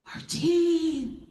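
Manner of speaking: high call across an airport; distant high hail; calling out a name. Retold or imitated. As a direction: call it out from far away.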